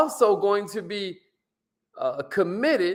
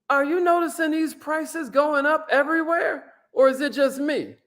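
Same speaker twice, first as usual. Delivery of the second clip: much higher, light chatty voice; friendly small talk; 8 semitones higher than usual. Shown as a demonstration. Salesman opening with small talk, high and chatty. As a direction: chat like a friendly stranger. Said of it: "maybe I say something like".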